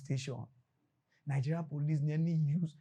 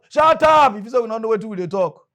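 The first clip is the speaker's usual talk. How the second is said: furious shout, much higher and louder; officer screaming down a suspect, angry shout; police yell; 6 semitones higher, 13 dB louder than usual. Retold or imitated. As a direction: scream shut up at the boy like a raging cop.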